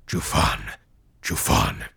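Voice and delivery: whispered then very low chant; ghostly whispered chanting; echoing ethereal energy being. A narrator's reading. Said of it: "chanted the crowd".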